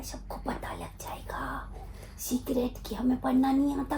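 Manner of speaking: soft conspiratorial whisper. Real, not acted. Mother whispering a secret to her baby, hushed.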